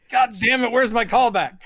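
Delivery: loud, high joking complaint; mock outrage. Podcaster demanding the missing callback, mock outrage. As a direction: complain loudly in playful indignation.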